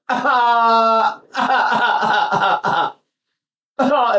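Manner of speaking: put-on high, cough-like baby wail; imitated baby cry. Shown as a demonstration. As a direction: cry in short pulsed bursts like an infant. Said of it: "And babies go".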